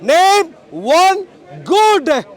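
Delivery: "good" drawn out slowly and set apart; stress on good. Real, not acted. Speaker clarifying the key word to hecklers, stress on "good". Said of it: "the key word I used is good".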